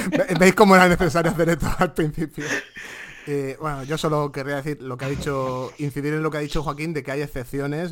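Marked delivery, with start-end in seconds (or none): 0.0-2.4 loud, higher voice through laughter; laughing. Real, not acted. Co-host reacting to his friend's long rant, laughing loudly. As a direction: laugh out the words, amused.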